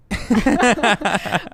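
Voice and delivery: loud burst of giggling; embarrassed giggle fit; shy laugh. Real, not acted. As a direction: laugh out loud at being put on the spot. Guest laughs, put on the spot.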